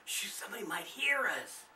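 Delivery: hushed unvoiced whisper. A narrator's reading. Darlene shushing the boys, whispering. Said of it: "Darlene whispered".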